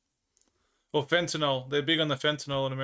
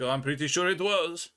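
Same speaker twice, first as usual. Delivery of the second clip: knowing tease. Retold or imitated. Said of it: "And I was like".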